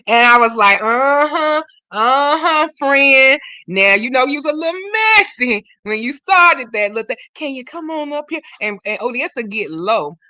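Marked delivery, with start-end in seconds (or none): 0.8-8.4 mock-scolding a friend, pitched up high; teasing singsong scold, summoning her forward; sassy mock callout. Host calling a messy friend to the front, in a sassy high tease.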